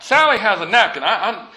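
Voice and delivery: ordinary delivery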